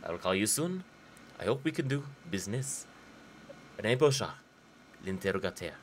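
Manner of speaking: hammed-up French accent reading a letter; stupid French accent. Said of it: "do my stupid French accent sometimes".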